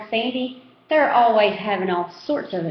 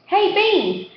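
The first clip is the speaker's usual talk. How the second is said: put-on high, boyish call down the lane; grubby kid calling; 9 semitones higher than usual. A narrator's reading. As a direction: call out cheekily to Beans. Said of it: "a grubby kid calls".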